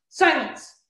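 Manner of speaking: usual speaking voice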